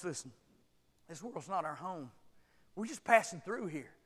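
normal talking voice

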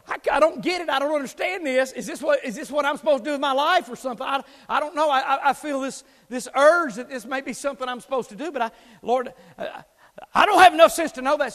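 earnest, loud, halting plea to God; a boy begging the Lord for answers; pleading prayer. Retold or imitated. Teen boy praying for a sign, loud pleading. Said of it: "And I'm praying, going".

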